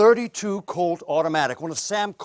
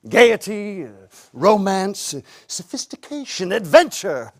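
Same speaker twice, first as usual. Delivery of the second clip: higher, theatrical ad-slogan delivery; salesman's pitch; 5 semitones higher, 3 dB louder than usual. Acted. Salesman pitching perfume to women, theatrical slogans. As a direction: sell each word as a grand promise.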